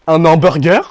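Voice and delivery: loud mock French "An hamburger?"; French accent. Cashier mishearing the order, loud mock French accent. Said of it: "He's like".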